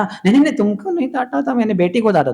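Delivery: high, hurried mock protest; flustered denial. Father protesting to goddess Lakshmi, high flustered denial. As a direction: plead your innocence in a squeaky rush.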